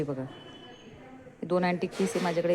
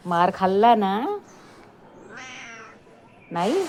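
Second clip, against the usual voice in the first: teasing question; 5 semitones higher, 7 dB louder, slower than usual. Real, not acted.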